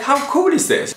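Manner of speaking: ordinary talk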